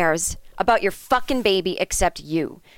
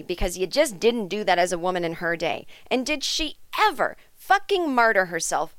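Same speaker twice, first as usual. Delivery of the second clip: usual speaking voice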